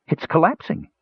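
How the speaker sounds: high-pitched gasp of alarm; alarmed cry. A narrator's reading. Parian to Flint as the cave wall melts, alarmed. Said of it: "Parian followed his gesture and gasped".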